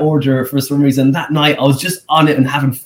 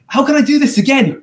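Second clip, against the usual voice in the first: elated; 12 semitones higher than usual. Retold or imitated. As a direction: beg for more, giddy with success. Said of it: "I was elated".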